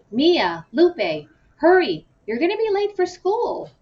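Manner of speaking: high, loud call upstairs; mom calling out. A narrator's reading. Mom hurrying the girls off to school. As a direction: call the kids from the next room. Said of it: "my mom called".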